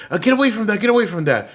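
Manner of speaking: high, urgent mock order; high-pitched repeated scolding; alarmed mother. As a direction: imitate a panicked mom shooing a child off. Mom orders boy away.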